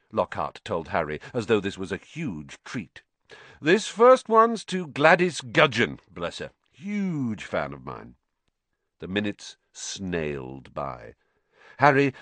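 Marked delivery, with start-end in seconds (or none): 3.6-8.1 starts high and slow, then sinks to a smug murmur; a vain star savouring his fan mail; Lockhart's voice. Acted.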